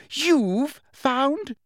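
very high You've found?, pitch leaping up; You've found? shrieked in outrage; shriller still. A narrator's reading. Umbridge outraged at Dumbledore, shrill. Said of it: "said Umbridge shrilly".

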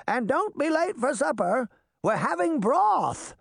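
put-on very high old woman's call; old lady hollering in a high pitch. A narrator's reading. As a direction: call after the children as they run off. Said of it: "she called".

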